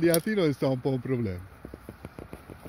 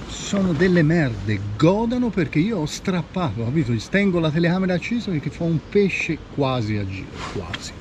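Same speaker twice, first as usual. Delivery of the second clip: breathless Italian goal commentary; 3 semitones higher than usual. Real, not acted. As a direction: shout it like a sportscaster. Fishing catch narrated to camera.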